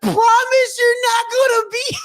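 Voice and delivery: high-pitched pleading wail; comic tearful begging; mock crying. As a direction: beg like a sobbing kid, hammed-up.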